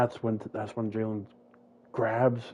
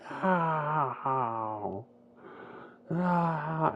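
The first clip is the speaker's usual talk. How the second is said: screaming; 7 semitones higher than usual. Acted. Victoria screaming as Jalen kills, high screams. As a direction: scream no as he's killed. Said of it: "Victoria screams".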